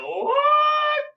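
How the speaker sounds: squeaky high disbelieving "What?"; shocked what. Retold or imitated. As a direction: react in outraged disbelief. Shocked reaction to battle results.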